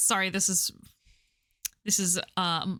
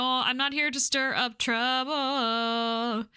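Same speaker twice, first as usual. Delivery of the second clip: slow, stretched words near usual pitch; slow, drawn-out delivery; 2 semitones higher, much slower than usual. Acted. Newcomer assuring the pub regulars, slow and drawn out. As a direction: drag it out, lengthening the last word. Second, stretched take of same line.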